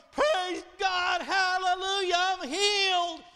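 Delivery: very loud, high shout of praise, slow at the end; healed man running back to Jesus, shouting praise; joyful hollering. Acted. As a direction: shout hallelujah at the top of your lungs. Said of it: "and hollering".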